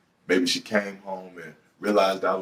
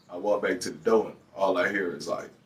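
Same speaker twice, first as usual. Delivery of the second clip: usual speaking voice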